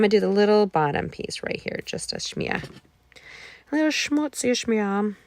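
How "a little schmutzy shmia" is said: butchered Yiddish. Pretend. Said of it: "I'm probably butchering Yiddish like crazy".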